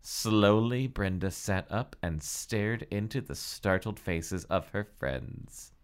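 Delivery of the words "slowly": usual speaking voice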